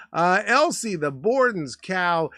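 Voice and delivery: normal talking voice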